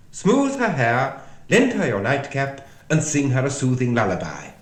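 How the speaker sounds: loud, high instructions; Red Queen. A narrator's reading. Red Queen orders Alice to soothe her, loud and emphatic.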